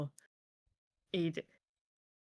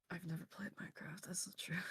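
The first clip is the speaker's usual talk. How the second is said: whispering; 12 dB quieter, faster than usual. Real, not acted. Joking whispered aside.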